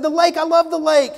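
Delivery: high, loud, eager enthusiasm; gushing salesman. Retold or imitated. Salesman bonding with a customer over the lake, high and eager. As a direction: gush about the lake like a hyped salesman. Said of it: "He said".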